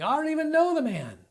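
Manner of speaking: high, loud, heated yell. A narrator's reading. Peter yelling at the courtyard crowd, heated and high. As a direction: shout the denial at the crowd.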